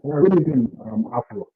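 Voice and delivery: muffled, like through a wall. Real, not acted. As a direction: voice muffled on a bad mic. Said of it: "you're sounding muffled".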